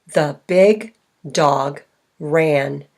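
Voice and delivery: slow, each word set apart; spaced-out demonstration sentence. Shown as a demonstration. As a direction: count out the words with pauses. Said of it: "How many words are in this sentence".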